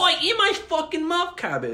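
very high exaggerated British heckle; mock British accent. Friend acting out the insult, high hammed-up British accent. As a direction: squawk it in a silly British accent.

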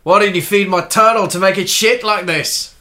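loud, high, slow Australian accent. Shown as a demonstration. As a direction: exclaim it as a broad Australian.

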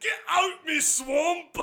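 high, slow mock ogre shout; Shrek. Chasing someone from the swamp, ogre impression shout. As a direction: drag out a joke ogre yell. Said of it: "Is it Shrek Irish?".

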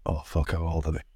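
muttered aside. Acted. Dad acting out forgetting kids' ages, muttered low. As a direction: drop it under the breath as if blanking.

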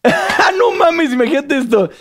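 high laughing words. Real, not acted. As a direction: laugh through the story. Recuerda la escena graciosa. (hablando entre risas)

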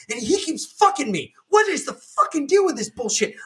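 high, exasperated retold complaint; mock outraged tirade, pitched high; imitated angry rant. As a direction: rant upward as the fed-up customer. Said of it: "lose her shit".

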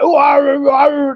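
hammed-up pirate "arr"; weird pirate. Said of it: "turned into some sort of weird pirate".

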